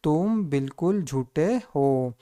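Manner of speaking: slow, word-by-word sentence; slow read-out. Shown as a demonstration. Teacher reading the finished sentence to learners, slow read-out. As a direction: draw out each word for the class. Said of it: "तो ये हो गया".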